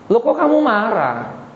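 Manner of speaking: high, falling challenge; incredulous reproach. Acted. God asks Yunus why he is angry, incredulous. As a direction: question him in high-pitched disbelief.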